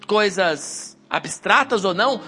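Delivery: ordinary delivery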